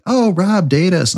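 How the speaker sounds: high-pitched imitation of girls; swooning girls. Host imitating girls who want to date him, high voice. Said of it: "all the girls are like".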